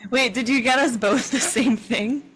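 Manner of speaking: ordinary talk, nothing marked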